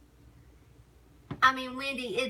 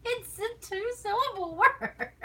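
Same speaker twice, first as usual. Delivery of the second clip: mocking husband; 4 dB louder than usual. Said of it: "That's what he says".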